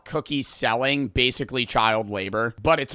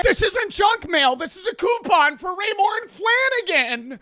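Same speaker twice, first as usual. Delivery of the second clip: high, loud mock-whiny dad; 3 dB louder than usual. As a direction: shout it as a hoarder dad, pitched way up. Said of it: "This was the le".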